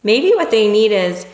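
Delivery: ordinary talk, nothing marked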